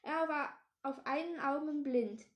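usual speaking voice

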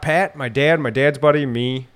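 ordinary talk

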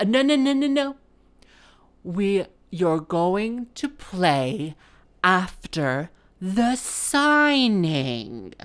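quick high no's, then very slow patronizing instruction; condescending vicar. Retold or imitated. Vicar overrules the musician about the signing, condescending. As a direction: cut her off, then drag out every word. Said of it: "This is how he talked to me".

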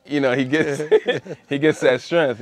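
laughing. Real, not acted. Laughing retelling, gaining strength.

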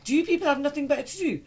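mock exasperated complaint to a caller; annoyed. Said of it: "So I got annoyed at him".

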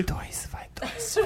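breathy ASMR whisper. Real, not acted. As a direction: whisper the count softly. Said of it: "[name] fazendo a S M R de conta".

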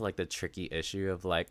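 ordinary talk, nothing marked

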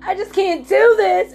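remembered late-night prayer in anguish; anguished plea. Retold or imitated. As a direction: cry out in pain, pitched well up. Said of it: "I was like".

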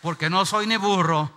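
loud, ringing declaration for the church to repeat; loud call and response. Shown as a demonstration. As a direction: proclaim it loudly so the church echoes you. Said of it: "Levanten sus dos manos y digan conmigo".

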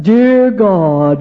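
mock-formal churchy address; stiff formal prayer. Shown as a demonstration. Speaker mocks the formal prayer voice. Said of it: "instead of".

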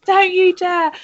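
high, loud, drawn-out mock screaming; outraged yelling, played for laughs; acted screaming. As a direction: scream abuse after a departing car, hammed up. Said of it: "screaming at the car".

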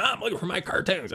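high-pitched mock child's whine; put-on high voice of a complaining child; protesting little daughter. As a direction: imitate a kid fussing at dad. Father voices his daughter's protest.

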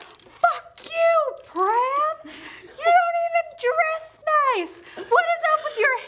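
high, loud imitation of a furious friend; drawn-out, hammed-up indignant yelling; mock angry outburst. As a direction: shriek it like an outraged diva. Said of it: "He stood up and he was like".

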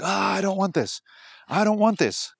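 put-on high childish protest against a new challenge; whiny inner voice. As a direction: play the scared part of yourself resisting. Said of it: "something inside me will always scream".

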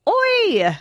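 high, drawn-out cartoon Irish voice; stumbling, giggly stage-Irish sprite; Lucky Charms leprechaun. Shown as a demonstration. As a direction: squeal it like a cereal mascot. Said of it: "do the Lucky Charms leprechaun".

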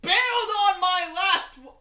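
mock his words back at him; loud mocking echo. Reno parroting the insult back, loud and slow.

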